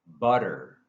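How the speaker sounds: butter, t flapped to d; flap t demo. Shown as a demonstration. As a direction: say butter like budder. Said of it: "It sounds like a D".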